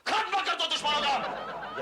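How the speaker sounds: rousing battle command, drawn out in places; rally the troops for a charge; military rallying cry. Acted. Major urging a henpecked husband to fight, rousing command.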